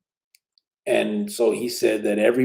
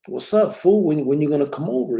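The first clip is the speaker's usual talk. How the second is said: higher, louder friendly challenge to a buddy; retold eager prodding; 6 semitones higher, 5 dB louder than usual. Speaker asking his brother to come over, eagerly prodding. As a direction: re-enact pressing a homeboy for an answer. Retells himself pressing brother to visit.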